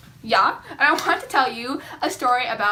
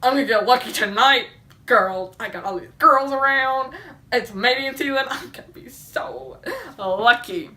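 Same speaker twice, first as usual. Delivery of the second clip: lightly voiced smug young elk, a bit louder; cocky bull elk; 3 dB louder than usual.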